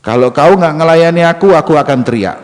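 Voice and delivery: high, loud ultimatum; acted threat. Woman threatens to scream.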